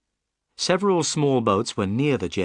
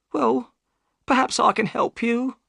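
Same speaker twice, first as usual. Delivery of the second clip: high-pitched upset woman; high female character voice, on edge; Missus Smith, upset; 13 semitones higher than usual. A narrator's reading. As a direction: voice a troubled wife, pitched very high.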